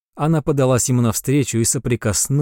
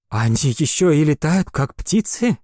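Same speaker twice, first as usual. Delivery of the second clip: admiring; 5 semitones higher than usual. Acted. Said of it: "восхищённо спросила Астра".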